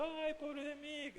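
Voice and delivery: high, slow mock whimper of self-pity; imitating someone crying over himself, high and slow. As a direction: whine it like a sorry crybaby, hammed up. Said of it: "¿Qué va a estar llorando ahí, diciendo".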